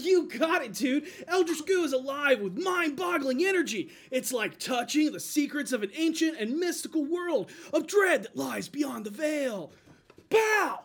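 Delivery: put-on loud, high, hyped kids commercial announcer; nineties toy ad voice. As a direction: sell it like a radical nineties toy ad.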